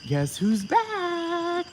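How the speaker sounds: high, slow, drawn-out taunt; high, slow, taunting sing-song; teasing comeback. Retold or imitated. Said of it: "I'm like".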